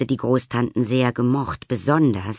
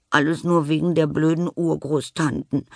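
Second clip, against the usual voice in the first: sniffling; 6 semitones higher than usual. Acted. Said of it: "schnieft sie".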